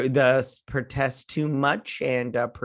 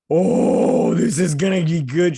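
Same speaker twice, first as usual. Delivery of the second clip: loud, high mock-excited outburst; gleeful anticipation; 6 semitones higher, 9 dB louder, slower than usual. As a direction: crow with exaggerated glee. Relishing the coming bad accent.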